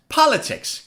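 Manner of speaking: "Politics" in one go, POL high and stressed; quick "Politics", falling from a high first syllable. Shown as a demonstration. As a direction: punch POL in a joined-up "Politics". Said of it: "Now say it faster".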